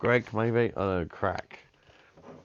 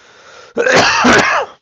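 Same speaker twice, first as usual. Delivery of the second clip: harsh loud cough; 16 dB louder than usual. Real, not acted.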